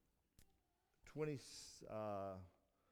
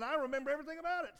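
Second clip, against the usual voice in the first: a hint of a bitter son, higher and fast; resentful older son; 13 semitones higher, 7 dB louder, much faster than usual. Preacher voicing the older son, lightly bitter and quick. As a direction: fire it off like a grudge-holder.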